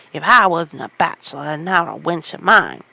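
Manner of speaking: put-on loud old gardener shouting; furious old man yelling over the wall; angry cry. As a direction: bellow it at the girl. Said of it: "he cried".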